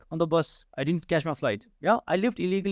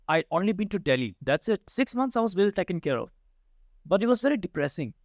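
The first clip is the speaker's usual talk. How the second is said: normal talking voice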